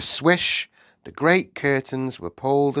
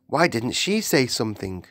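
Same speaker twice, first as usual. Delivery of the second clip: plain reading voice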